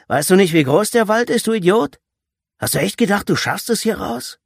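high, loud, incredulous scolding; angry berating. A narrator's reading. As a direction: berate a cornered captive. Leader berates captured victim.

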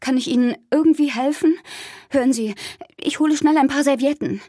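slightly hysterical. A narrator's reading. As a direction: offer help in a mild panic. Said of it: "Leicht hysterisch lief ich". (leicht hysterisch, aufgeregt)

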